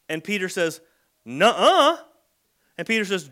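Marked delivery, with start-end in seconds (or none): 1.2-2.0 a sharp, high, loud "nuh-uh"; blunt childish no; indignant refusal. Retold or imitated. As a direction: shoot the idea down like a defiant kid. Peter flatly refusing Jesus.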